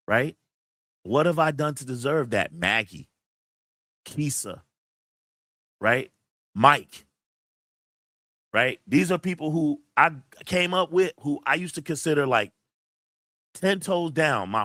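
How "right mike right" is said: normal talking voice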